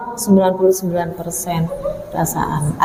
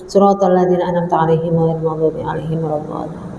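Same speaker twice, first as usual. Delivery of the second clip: low, level Al-Fatihah recitation; prayer recitation; 7 semitones lower than usual. Real, not acted. Preacher closing the lesson with Al-Fatihah, reciting. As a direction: recite evenly on a low note.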